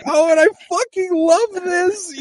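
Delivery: tearful fan overwhelmed by joy, high pitched. Retold or imitated. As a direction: choke up with happiness. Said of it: "I was like".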